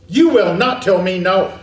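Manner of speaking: loud, stern mock reprimand; forceful telling-off, hammering each word; scolding parent. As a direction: imitate an indignant parent laying down the law. Parent scolding the baby, voiced.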